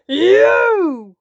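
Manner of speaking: loud, high sudden cry; the wolf lunges with a shout; wolf's cry. Acted. Wolf cries out at Granny, loud and high. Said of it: "cried the wolf".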